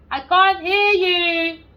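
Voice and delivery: high, loud, slow shout; mock shout. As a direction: bellow it as if down a bad line. Mock deaf to caller.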